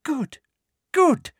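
high, sugary satisfaction; pleased Umbridge. A narrator's reading. Umbridge pleased watching Ginny struggle, sweet. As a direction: savour it sweetly. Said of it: "said Umbridge".

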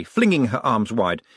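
ordinary delivery, nothing marked

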